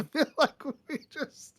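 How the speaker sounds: laughing through the words; breaking into laughter mid-sentence. Real, not acted. Laughing at the table.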